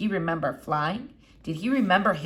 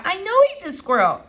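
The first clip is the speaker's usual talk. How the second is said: put-on much higher, louder exasperated girl; exasperated Flora; 11 semitones higher, 7 dB louder than usual. A narrator's reading. Girl exasperated by the doctor, much higher. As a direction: throw up your hands at the doctor.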